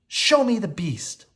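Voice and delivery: high, slow firm command; demanding. A narrator's reading. Belle demanding the mirror show the beast. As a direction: order the mirror to show him. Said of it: "she demanded".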